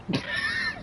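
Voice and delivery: high wheezy burst of laughter; high squeaky laugh. Real, not acted. Friend cracks up retelling the movie, squeaky laugh. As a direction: let out a squeaky giggle.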